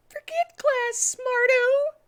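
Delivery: very high, loud squeaky shout; shouting Cream. Acted. Cream dismissing class to Tails, squeaky shout. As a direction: yell it in a tiny girl voice. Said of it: "said Cream shoutingly".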